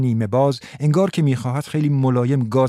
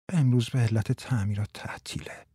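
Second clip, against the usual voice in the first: soft whispered joke; 6 dB quieter than usual. Acted. Martha whispering a joke to Frans. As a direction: whisper it like a secret. Joking secret aside.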